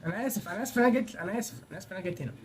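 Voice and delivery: fast, hushed apology; hasty apology. Real, not acted. Player apologising for having come here, hasty and hushed. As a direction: apologise quickly, fading very soft.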